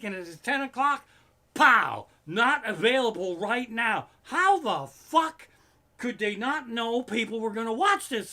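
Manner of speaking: screaming frustration at the streaming service; furious rant. Real, not acted. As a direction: vent outrage at the outage. Finale night outage rant.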